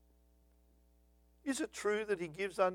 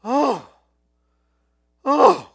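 mock exasperated oh; 6 semitones higher, 13 dB louder than usual. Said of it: "Martha was just like".

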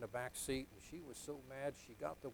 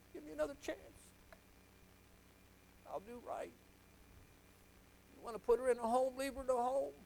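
pleading; 13 semitones higher, 7 dB louder than usual. Retold or imitated. Preacher retelling his night-long plea to God, pleading. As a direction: beg God, voice rising high and growing loud. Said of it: "pleading with God".

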